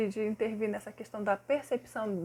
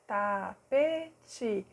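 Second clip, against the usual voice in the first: ta-pe-te spelled out slowly, syllable by syllable; slow teacher syllable count. Shown as a demonstration. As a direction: stretch "tapete" into its three beats. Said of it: "a gente fala pausadamente".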